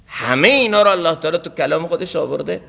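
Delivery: usual speaking voice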